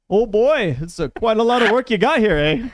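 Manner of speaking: put-on cheery high Canadian accent. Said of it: "The fire wizards speak in a Canadian accent".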